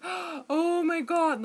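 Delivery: high, loud, drawn-out mock dismay; gasp, then slowly wail in horror; hammed-up appalled reaction; acted horror. Acts out hearing own voice.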